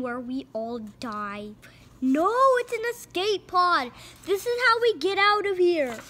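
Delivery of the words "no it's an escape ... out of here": high loud triumphant shout; excited cartoon hero. Crook telling the gang he found an escape pod. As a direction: announce the escape pod with glee.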